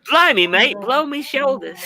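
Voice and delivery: high Cockney caricature; British accent. Shown as a demonstration. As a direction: echo a hammed-up Cockney. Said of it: "wants to see a British accent".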